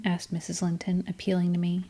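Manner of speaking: ordinary delivery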